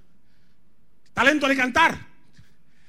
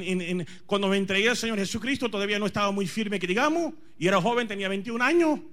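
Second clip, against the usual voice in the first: ordinary talk, nothing marked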